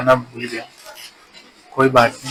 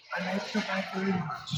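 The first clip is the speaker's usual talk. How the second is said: imitating auntie; 7 semitones higher, 18 dB quieter, much slower than usual. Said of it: "फिर से उन्होंने कहा कि".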